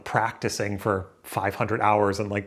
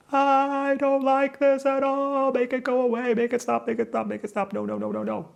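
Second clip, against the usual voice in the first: put-on whiny childlike voice. Talking to herself on camera, whiny high plea. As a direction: beg like a scared little kid.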